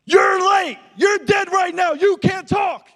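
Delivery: high, furious screaming drill instructor; drill sergeant shout. Retold or imitated. Pastor screaming at his late youth pastor, furious high shout. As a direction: bark it like a Marine screaming an inch from a face. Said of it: "He said".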